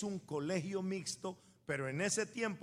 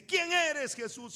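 mock shout; 9 semitones higher, 6 dB louder, slower than usual. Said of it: "Saulo's reply".

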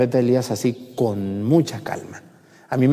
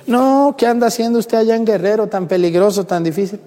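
much higher, louder, imitating worried people; mock alarmed concern; 9 semitones higher, 4 dB louder than usual. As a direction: fuss as if he's in danger. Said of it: "me dicen".

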